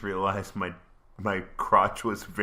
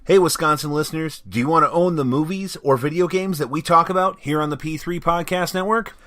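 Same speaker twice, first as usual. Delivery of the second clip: fast, upbeat ad read; 5 semitones higher, 7 dB louder, faster than usual. Acted. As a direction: sell it like a radio spot. Reads sponsor ad.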